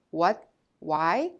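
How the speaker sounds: ordinary talk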